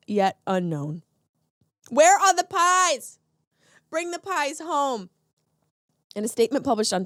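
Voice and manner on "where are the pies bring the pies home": mock plea. Mock plea for stolen pies.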